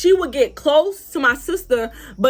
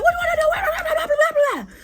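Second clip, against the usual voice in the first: very high mocking gabble; boosting up; faster than usual. Rival boosting herself up for a fight, mocking high-pitched gabble. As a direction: mimic her hype, dissolving into squeaky babble. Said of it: "boosting up hard as fuck".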